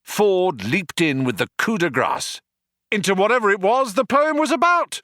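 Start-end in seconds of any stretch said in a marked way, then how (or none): 2.9-5.0 very high, louder, fast yell. Acted. Ford yells the finish to rescue Arthur. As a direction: blurt it out in a rush. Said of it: "he yelled".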